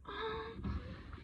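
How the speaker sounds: high, sudden shocked gasp; sharp gasp. Retold or imitated. Woman recalling the insult, gasping in shock. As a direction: suck in air in outrage. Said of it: "And I was like".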